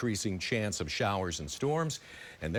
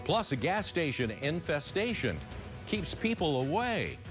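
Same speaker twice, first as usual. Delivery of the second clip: news tease read; 4 semitones higher than usual. Acted. Anchor teases upcoming stories.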